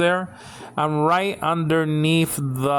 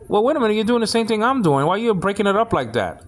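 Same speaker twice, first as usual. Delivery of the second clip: higher-pitched mock protest; quick, incredulous retort, retold; retold challenge; 7 semitones higher, faster than usual. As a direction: replay your objection to a critic, pitched up. Acts out his retort to critic.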